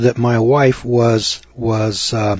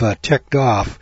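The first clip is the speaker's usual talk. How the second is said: ordinary talk, nothing marked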